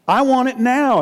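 put-on high, loud childish demand; demanding whine. Believer demanding from God, high childish whine. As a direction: demand it like a spoiled kid.

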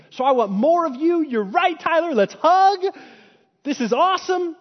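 thrilled to be corrected, almost shouting; joyful exclaim. Retold or imitated. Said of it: "but I could say".

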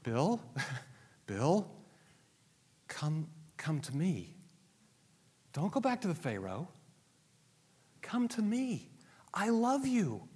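soft, unhurried pleading with gaps between phrases; tender, beckoning appeal; Jesus calling tenderly. Acted. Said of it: "What's Jesus doing?".

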